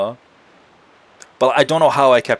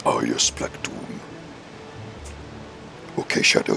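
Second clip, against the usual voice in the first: very low, voiceless villain rasp; 14 semitones lower, 8 dB quieter than usual. Acted. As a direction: drop into a husky sinister overlord voice.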